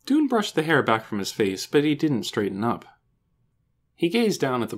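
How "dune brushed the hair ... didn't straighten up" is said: plain reading voice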